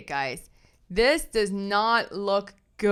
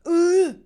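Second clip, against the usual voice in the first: loud, high "ugh"; disgusted groan; 9 semitones higher, 10 dB louder than usual. Real, not acted. Commentator fed up with the on-camera antics, loud disgusted groan. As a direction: groan loudly in disgust, pitched right up.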